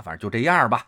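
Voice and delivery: usual speaking voice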